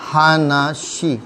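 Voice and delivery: हानाशी said slowly and softly, syllable by syllable; slow careful हानाशी; slow teaching pronunciation. Shown as a demonstration. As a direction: draw out हानाशी gently for the class. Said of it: "इसको पढ़ते हैं".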